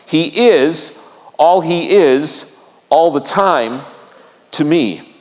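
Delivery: very slow, phrase by phrase; deliberate slogan. Real, not acted. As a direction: let each phrase land before the next. Preacher presents his own defining line.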